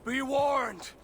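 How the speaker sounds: loud, high battle threat; shouted warning. Acted. Warrior shouting back a threat at his enemy, loud and high. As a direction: roar the warning at the foe.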